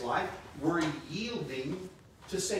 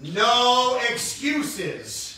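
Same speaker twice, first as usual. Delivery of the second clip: No excuses drawn out long, louder and higher; stronger call; 6 semitones higher, 9 dB louder, much slower than usual. Shown as a demonstration. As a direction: make them shout it back. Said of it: "Wow, that was weak".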